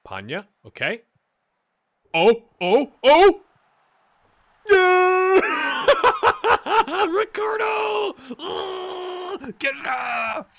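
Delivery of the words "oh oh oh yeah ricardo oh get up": high, loud ecstatic yelling; goal celebration. Real, not acted. Streamer to viewers, celebrating a goal thirty seconds in, screaming with joy.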